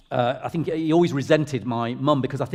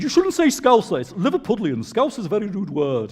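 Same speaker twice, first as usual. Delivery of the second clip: high-pitched comic telling-off; Ken Dodd; 7 semitones higher, 4 dB louder than usual. Retold or imitated. Comedian correcting the speaker on the word Scouser, high mock scolding. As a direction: imitate a comedian's reproach, voice pitched right up. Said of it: "I got told off by Ken Dodd".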